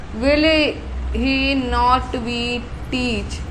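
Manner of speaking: very slow, word by word; slow dictation. Shown as a demonstration. Teacher dictating to students, very slow and drawn out. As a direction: spell it out slowly for the class.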